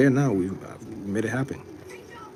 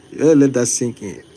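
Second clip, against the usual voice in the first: ordinary delivery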